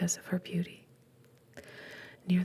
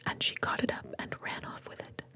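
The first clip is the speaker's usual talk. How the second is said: ordinary delivery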